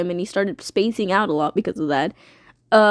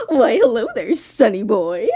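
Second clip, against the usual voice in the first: lightly voiced sly villain; sly villain greeting. Blood Moon greets Sun, in a smug, lightly voiced villain tone. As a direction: greet him with smug menace. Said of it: "and says".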